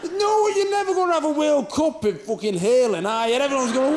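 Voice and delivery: ordinary talk, nothing marked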